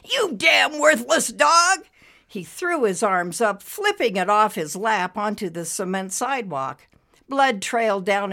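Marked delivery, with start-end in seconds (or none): 0.0-1.9 slow, loud, high-pitched cursing; angry scream. A narrator's reading. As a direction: scream abuse at the dog, drawn out. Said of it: "he screamed".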